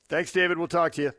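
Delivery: ordinary delivery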